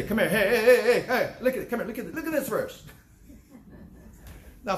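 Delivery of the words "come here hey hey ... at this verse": higher and louder, laughing while hammering hey hey; mock pushy preacher. Preacher mocking a pushy witness to his flock, laughing and loud. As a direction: ham up a preacher grabbing people's sleeves.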